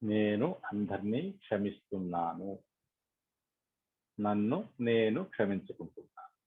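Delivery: soft, measured forgiveness affirmations; guided meditation affirmation. Acted. Leader guiding meditators to forgive all and themselves, softly meditative. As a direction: recite softly, with a lift on the opening words.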